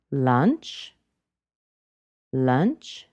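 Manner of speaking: "lunch" flicked up at the end; unfinished, rising "lunch"; rising intonation. Shown as a demonstration. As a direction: lift "lunch" as if continuing. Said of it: "She's continuing on".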